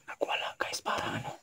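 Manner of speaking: hushed unvoiced whisper. Real, not acted. Vlogger whispering to viewers during a haunting, hushed whisper. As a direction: whisper it so the spirit won't hear.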